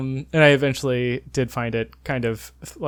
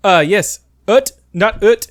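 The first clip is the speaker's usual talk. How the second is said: loud, higher mock French pharmacist; French accent, imitated; 7 semitones higher, 9 dB louder, slower than usual. Pharmacist asking a tourist which brace, in imitated French accent. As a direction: put on a French accent, dropping the h. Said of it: "he's, like, so annoyed".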